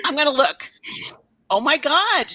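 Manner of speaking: usual speaking voice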